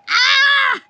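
high, loud cry of joy; thrilled shriek. Real, not acted. Prospector shrieks as the nugget shows, thrilled. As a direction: let out a real squeal.

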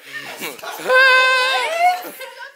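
high, shrieked burst of laughter; shrieking laugh. Real, not acted. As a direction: let out a squealing shriek of laughter. Shocked reaction to kiss.

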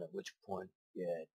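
ordinary talk, nothing marked

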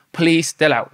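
ordinary talk

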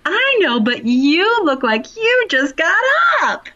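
mock the neighbor's cheeky jab; the neighbor ribbing her at the mailbox; imitating neighbor Bob. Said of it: "And he'll say".

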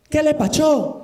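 a hint of mock Chinese accent through a laugh. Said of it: "pongan hablado de chino".

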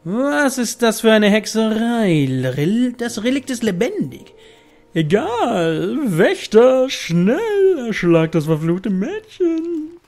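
high, loud villain command; evil queen. Acted. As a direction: shriek orders at the guards. Noble orders guards to kill.

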